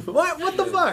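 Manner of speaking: high squeaky what the fuck through laughter; shocked laugh. Real, not acted. Reacting to the dog picture credit, laughing and shocked high. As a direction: yelp it out in laughing disbelief.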